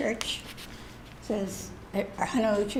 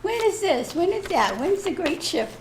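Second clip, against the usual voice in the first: higher-pitched string of questions; mock pestering questions; 8 semitones higher than usual. Student recalling her questions to her guide, playfully pestering. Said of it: "when I ask a question".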